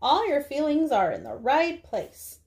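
ordinary talk

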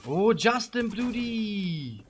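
long drawn-out, high hail; delighted greeting. Real, not acted. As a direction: stretch the name out as a joyful welcome. Greets an arriving viewer. (freudig langgezogener Begrüßungsruf)

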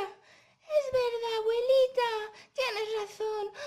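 put-on high, drawn-out little-girl agreement; earnest Caperucita. Caperucita agreeing with grandma's advice, earnest and high. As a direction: concede in a high puppet voice.

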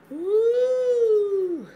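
high, loud whoop of freedom; loud high whoop of release; joyful woo. Real, not acted. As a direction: let out a big happy woo. Joyful release after anointing hair.

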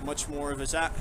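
ordinary talk

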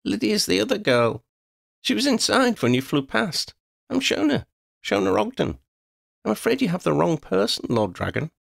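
put-on high girl's voice, earnest denial; a young girl talking back to a dragon; insistent girl. A narrator's reading. Girl denies name to dragon, high insistent voice.

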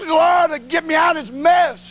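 desperate plea. Retold or imitated. Desperate prayer in toilet stall.

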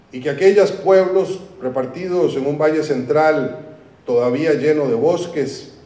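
ordinary delivery

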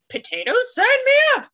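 hammed-up eagerness for potatoes; mock eager. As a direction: squeal it like a thrilled volunteer. Mock eager self-quote.

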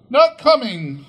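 high, loud mock-serious reply; teasing mother. A narrator's reading. Missus Cratchit teases Bob that Martha stays away, high mock reply. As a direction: play the joke straight, high and loud.

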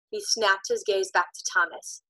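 ordinary delivery, nothing marked

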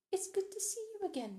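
lightly acted, high-pitched courteous greeting; polite greeting. A narrator's reading. Guest returns the society matron's greeting, politely high-voiced.

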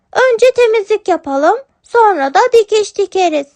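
sweet kitten reassuring her father. Acted. Kitten plans chores. (yavru kedi sesi, istekli)

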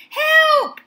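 very high, loud call for help; urgent cry. A narrator's reading. Benny to boys playing ball, high urgent cry. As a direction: cry out in high-pitched alarm. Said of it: "he gasped".

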